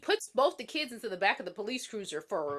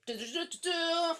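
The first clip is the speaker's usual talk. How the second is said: higher shouted nonsense syllables; mock battle cry; 7 semitones higher than usual. Rachel charging at the girl with a cry. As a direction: yell it like charging into a fight. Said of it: "she goes".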